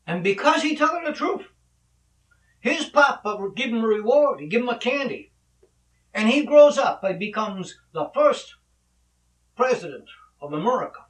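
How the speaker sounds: high, loud Italian father telling a tale; hammed-up Italian accent, driving the moral home; bad Italian accent. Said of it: "my Italian accent sucks".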